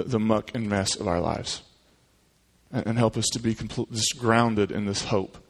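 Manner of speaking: soft, low prayer voice. Acted. Pastor closing in prayer, soft and low. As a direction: pray quietly over the congregation.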